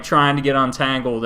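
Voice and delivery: ordinary delivery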